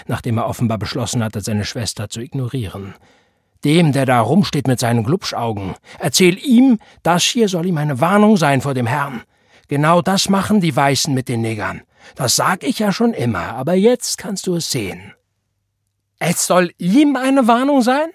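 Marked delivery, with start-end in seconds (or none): 3.6-6.8 loud, rising venomous order. A narrator's reading. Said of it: "giftete er seine Frau an". 7.0-9.2 loud, raised stern warning; venomous warning. A narrator's reading. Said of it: "giftete er seine Frau an". 9.7-15.1 raised, bitter tirade; venomous. A narrator's reading. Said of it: "giftete er seine Frau an". 16.2-18.1 very high, loud incredulous yell; outraged shout. A narrator's reading. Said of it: "schrie Tante Florence".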